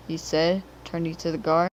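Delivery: ordinary delivery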